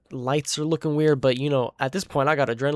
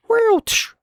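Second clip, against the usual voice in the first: high rising whoo then tsh; mock whoosh effect; imitated whoosh and crash; 8 dB louder than usual. Shown as a demonstration. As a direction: make the swish of a thrown rock. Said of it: "it didn't go".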